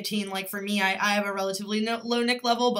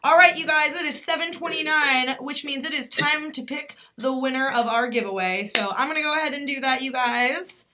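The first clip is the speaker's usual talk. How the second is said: normal talking voice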